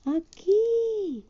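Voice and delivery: very high drawn call. Acted. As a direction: call out to the mother dragon. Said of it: "gritaba Winnie".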